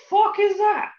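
high, loud outburst of bafflement; mock disbelief. Co-host has no idea who the artist is, loud mock disbelief.

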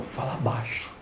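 hushed, secretive whisper. Retold or imitated. The watcher warns the dreamer to keep quiet, whispering. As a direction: breathe it out like a secret warning.

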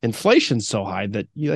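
ordinary talk, nothing marked

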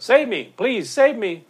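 loud, high acted plea for rescue; mock desperate cry. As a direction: shout for help as the drowning swimmer. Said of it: "he cries out".

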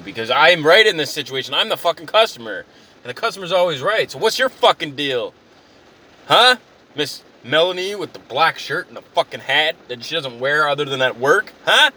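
sudden agitated rant; indignant customer. Acted. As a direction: flip from apology into righteous outrage. Apology flips into acted quarrel.

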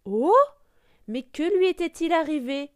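loud, high-pitched gasp of wonder; astonished. A narrator's reading. Narrator reacts to the duckling's reflection, astonished. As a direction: burst out in amazement.

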